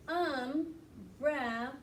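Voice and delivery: umbrella stretched slowly, each syllable clear. Shown as a demonstration. Teacher modelling for the class, slow and syllabic. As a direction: draw out umbrella in beats. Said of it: "circle the number three".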